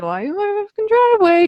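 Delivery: high indignant outburst; high, incredulous outrage; outraged. Retold or imitated. Retold outraged thought.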